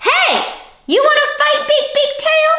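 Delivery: high, squeaky challenge; grouchy ladybug. Acted. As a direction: pick a fight in a tiny voice.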